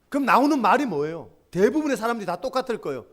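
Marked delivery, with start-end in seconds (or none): none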